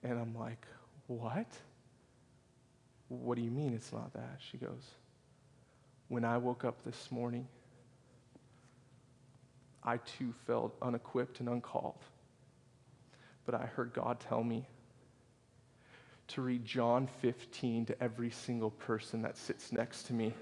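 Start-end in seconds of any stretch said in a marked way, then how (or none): 1.0-4.4 soft, puzzled questioning; retold quiet disbelief; hushed bafflement. Acted baffled question to the lady. 6.1-20.3 soft, measured testimony with long pauses; gently retold revelation; hushed confession. Tearful lady's confession, retold.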